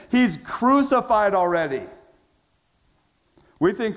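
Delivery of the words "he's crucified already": scoffing. Retold or imitated. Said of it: "They scoffed at him".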